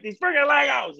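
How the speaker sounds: mock toddler tantrum, high; frustrated little girl. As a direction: play the kid who can't fit the bricks. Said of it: "she's like".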